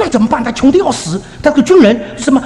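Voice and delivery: high-pitched, mock-worried protest; worked-up retold objection; retold fretting. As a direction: fret aloud at your husband, high and pressing. Acted quarrel with husband.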